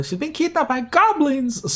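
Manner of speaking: a hint of a fretful quest giver; worried villager. Reading out the villager's worry, lightly voiced fretful NPC.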